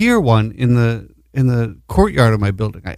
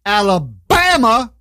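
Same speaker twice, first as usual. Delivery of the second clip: drawn-out, high-pitched movie-quote Alabama; Forrest Gump; 7 semitones higher, slower than usual. Retold or imitated. Host announcing the state, Forrest Gump-style drawl. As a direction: stretch it out and pitch it up. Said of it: "why I have to say it like that".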